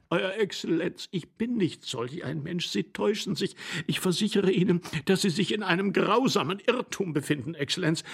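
high, whimpering appeal; anguished assurance of innocence; desperate pleading. A narrator's reading. Accused man begs.

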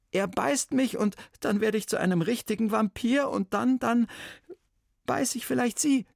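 put-on nervous boy blurting out his trouble; boy's voice.

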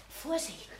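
high, hushed warning. Acted. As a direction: warn softly, barely aloud. Warns of someone coming.

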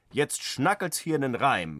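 normal talking voice

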